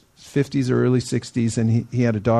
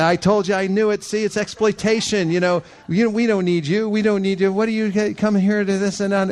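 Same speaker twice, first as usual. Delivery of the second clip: higher-pitched, worked-up imitation of an angry man; mock crazy rant; 6 semitones higher than usual. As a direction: play the indignant stranger ranting, pitched up. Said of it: "he went crazy".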